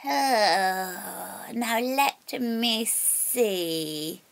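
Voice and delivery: put-on very slow, sly little old witch voice. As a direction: draw it out like a crafty crone. Old witch considers slyly.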